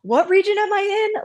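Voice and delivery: a high, confused squeak of questions; flustered high voice. Retold or imitated. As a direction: play it scatterbrained and high. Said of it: "and then I'm like".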